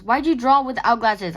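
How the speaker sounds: loud, incredulous question; scolding. Real, not acted. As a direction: scold your younger self. Scolding past self.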